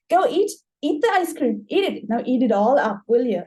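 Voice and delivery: force-feed them ice cream with venom; hostile command. Acted. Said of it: "and says".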